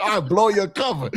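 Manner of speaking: high, giggly taunt; laughing taunt. Retold or imitated. Taunting the marshal while laughing. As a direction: tease him through laughter. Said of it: "And I was like".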